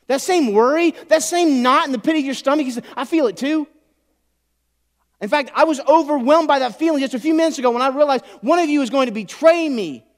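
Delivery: loud, high, urgent preaching; preaching at full force. Acted. Pastor pleads with the congregation, loud and urgent. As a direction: press it home to the congregation, full voice.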